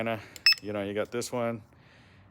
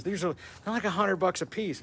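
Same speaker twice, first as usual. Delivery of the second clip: usual speaking voice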